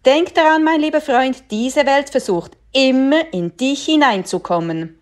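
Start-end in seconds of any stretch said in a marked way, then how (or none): none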